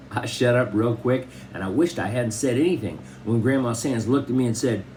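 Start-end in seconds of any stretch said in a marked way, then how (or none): none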